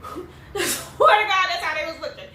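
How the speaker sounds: slow, laughing through the words. Real, not acted. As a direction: crack up while swearing it. Laughing at sister's reaction.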